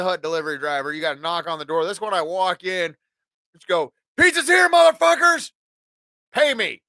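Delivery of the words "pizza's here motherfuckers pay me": shouting. Retold or imitated. Barging into band house with pizza.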